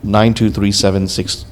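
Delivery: ordinary talk, nothing marked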